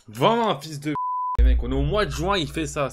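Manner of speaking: insulte criée, plus haut; furieux. Real, not acted. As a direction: crie sa rage contre l'adversaire. Insulte l'adversaire. (furieux, fort)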